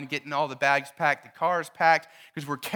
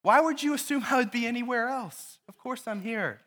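put-on teen son; 6 semitones higher than usual. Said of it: "your son looks at you and goes".

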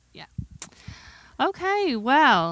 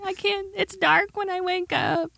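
exhausted whine; 13 semitones higher, 5 dB louder than usual. Retold or imitated. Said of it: "and I'm like".

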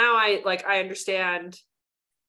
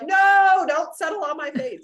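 pleading outcry; 9 semitones higher, 7 dB louder than usual. Retold or imitated. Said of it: "'cause I'm like".

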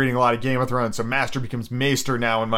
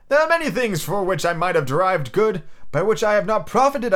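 higher, eager voice; Scrooge's nephew, earnest; 5 semitones higher than usual. Acted. Nephew answering his uncle, high earnest argument. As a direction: make the case earnestly, pitched up.

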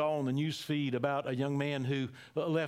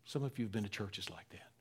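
soft, lower whisper; 4 semitones lower, 5 dB quieter than usual. Real, not acted. Pastor speaking to people wounded by churches, whispering. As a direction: confide it gently to the hurt. Gentle confession to hurt listeners.

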